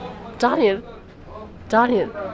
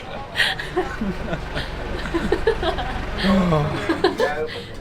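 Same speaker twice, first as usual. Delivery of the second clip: helpless laughter; 4 dB quieter than usual. Real, not acted. Laughing hard at friend.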